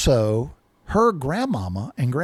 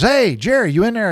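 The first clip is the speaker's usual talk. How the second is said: mild, higher-pitched mock call through a door; 7 semitones higher than usual. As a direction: call for someone inside, lightly acted. Shouting through door to Jerry.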